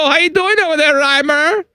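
high, hammy German voice; German accent. Pretend. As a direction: greet him in a thick German accent. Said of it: "Doing good, Wolfgang".

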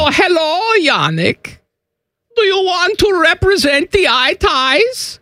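high, exaggerated German accent. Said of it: "my German accent is non-sexual".

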